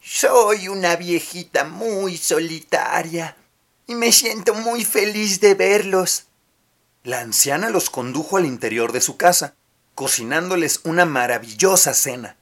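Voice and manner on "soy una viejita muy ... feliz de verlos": put-on slow, high, sugary old woman; sweet old lady. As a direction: lure them in like a kindly granny. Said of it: "sonrió muy amablemente".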